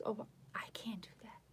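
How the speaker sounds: whispered aside, as the others; mock whisper. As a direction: breathe it out in a whisper. Others voiced in the story.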